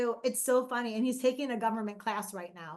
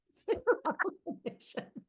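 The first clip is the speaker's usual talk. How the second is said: laughing whisper; 6 semitones higher, 4 dB quieter than usual. Retold or imitated.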